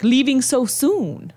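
a light put-on Nigerian accent. The creepy gym guy asking if she is leaving, in a slow Nigerian accent. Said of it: "He even had that accent".

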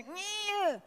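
reluctant nyeh. Retold or imitated. Mocking reluctant givers.

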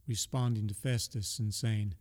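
ordinary talk, nothing marked

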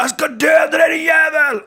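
high, loud roaring shout; enraged threat. Retold or imitated. Man with an axe storming in, furious shout. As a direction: bellow it like an attacker.

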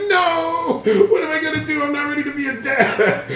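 high panicked wail with laughs; laughing, hammed-up terror; mock panic. Retelling his panic about being a dad, high and laughing.

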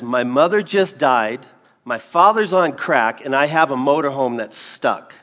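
loud, anguished outburst; distraught man. Retold or imitated. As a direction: pour out his troubles at full volume. Distraught man voiced in story.

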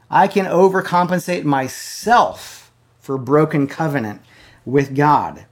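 loud, high fiery preaching; preaching at full force. Acted. Preacher driving home blasphemy to his online church, loud and fervent. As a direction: thunder it at the congregation.

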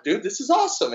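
mock excited. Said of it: "I'm like".

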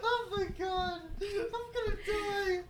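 high, wheezing laughter, breaking up; crying with laughter. Real, not acted. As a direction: laugh till tears.

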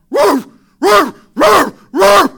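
loud high barking like a dog. Retold or imitated. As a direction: bark it out like a dog that spotted a cat. Preacher imitates his barking dog.

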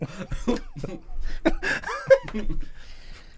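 loud high cackling laugh; big laugh. Real, not acted. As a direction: laugh hard at your own joke. Laughing at own joke.

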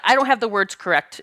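ordinary talk

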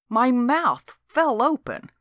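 ordinary delivery

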